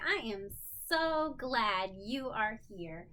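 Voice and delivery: ordinary delivery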